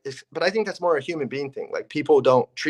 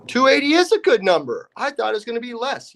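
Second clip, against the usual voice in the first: a realtor hearing an offer higher than expected; pleasantly surprised realtor; 8 semitones higher, 3 dB louder than usual. Retold or imitated. Realtor reacting to the agent's cash offer, surprised and pleased. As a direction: voice jumps up, caught off guard by the good number.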